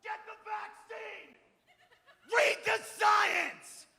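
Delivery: shouted high, last words slow and loud; mock activist yelling. Protester shouting at vaccine skeptics, high mock yell. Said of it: "I was one of those guys that was out there like".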